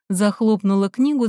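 usual speaking voice

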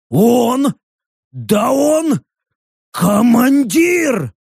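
high, stretched-out objection; indignant protest. A narrator's reading. Orc objects to his commander, indignant. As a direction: protest high, drawing out the last word.

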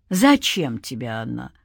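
low, unhurried question; sinister spirit. A narrator's reading. Spirit questioning a man's demand, sinister. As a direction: drop the voice deep and probe.

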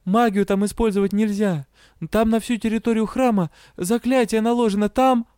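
panicky, about to burst into tears; frightened girl pouring out the problem, near tears; on the verge of tears. Acted. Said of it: "Не реви!".

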